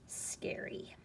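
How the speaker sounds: soft unvoiced whisper of the answer; hushed hint to the class.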